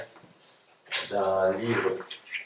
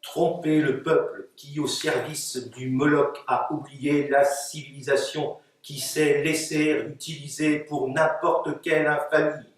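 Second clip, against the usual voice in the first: steady, declaimed verse in long phrases; poetry reading; 5 semitones higher, 4 dB louder than usual. Acted. Poet reading her text to an audience, steady declamation. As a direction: declaim the poem with careful articulation. Poets read their texts aloud.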